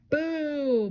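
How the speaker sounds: high, loud ghostly boo; yelled. A narrator's reading. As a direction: yell it out to startle. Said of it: "Boris yelled".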